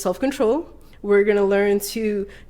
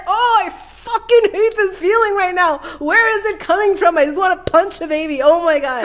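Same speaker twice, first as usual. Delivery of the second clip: higher and louder, hammed-up frustration; retold rage; 8 semitones higher, 4 dB louder than usual. Telling how the sudden anger felt, hammed-up rage. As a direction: vent it like you're about to burst. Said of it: "And I'm just like".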